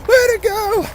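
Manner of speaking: high hollering cheer; rowdy cheer. Retold or imitated. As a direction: holler it from the crowd. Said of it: "hooting and hollering".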